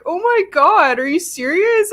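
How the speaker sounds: high, alarmed disbelief; retelling a stunned reaction, pitched high; shocked disbelief. Retold or imitated. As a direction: gasp it out like the news just hit you. Told car is a total loss.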